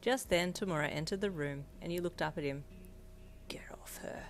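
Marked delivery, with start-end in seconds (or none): 3.5-4.3 hushed, whispery growl; low growl. A narrator's reading. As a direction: growl it very quietly, under the breath. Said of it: "he growled to Darby".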